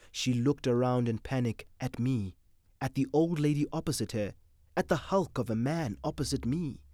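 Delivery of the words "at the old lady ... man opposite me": ordinary delivery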